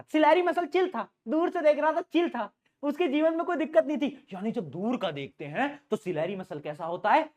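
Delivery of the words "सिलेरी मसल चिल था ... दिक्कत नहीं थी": pitched up, teasing the relaxed muscle; playful and light, voice lifted high; high playful sing-song. Shown as a demonstration. Teacher jokes with class about the chill muscle, high playful tone. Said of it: "ज्यादा funny हो जाता है".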